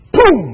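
shouted sledgehammer boom; imitated hammer strike; loud boom. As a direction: slam the sound out loud and high.

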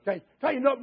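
usual speaking voice

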